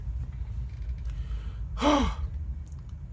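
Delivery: long airy sigh, very soft; a sigh of being overwhelmed; soft breathy sigh. Real, not acted. Sighing while reading the emotional manga chapter.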